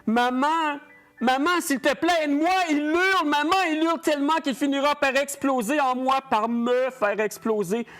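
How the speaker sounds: crying out to maman in panic; desperate pleading. Acted. Pleading with his dead mother. (supplication désespérée, aiguë et forte)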